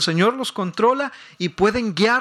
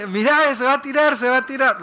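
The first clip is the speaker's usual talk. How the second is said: excited bystander; 6 semitones higher, faster than usual. Retold or imitated.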